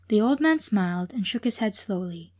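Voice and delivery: plain reading voice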